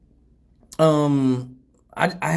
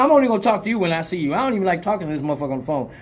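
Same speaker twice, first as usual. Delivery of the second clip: ordinary delivery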